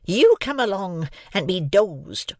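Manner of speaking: slow stern sister; awful words. A narrator's reading. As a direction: threaten the boy as the sister. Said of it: "the awful words".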